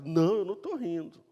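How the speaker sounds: imitating someone caught out and protesting; insistent denial. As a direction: blurt out an emphatic denial. Sara insists when God contradicts her.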